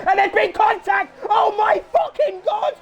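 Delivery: high, loud crash yell; shocked shout. Real, not acted. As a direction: scream it out in disbelief. Big crash called live.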